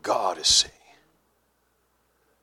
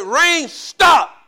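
retold angry shout, higher and louder; mad command; 5 semitones higher, 7 dB louder than usual. Pastor commanding the storm clouds, mad command. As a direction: order it off in mock fury. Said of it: "got so mad at them clouds".

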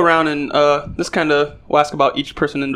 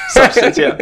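word squeezed out through a laugh; amused, half-laughing echo; 13 semitones higher, 6 dB louder than usual. Real, not acted. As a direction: laugh while repeating the word. Laughs at friend's correction.

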